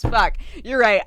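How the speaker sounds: ordinary delivery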